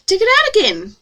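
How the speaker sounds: high, loud mock British accent; cheerful British accent. A narrator's reading. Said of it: "in a lovely British accent".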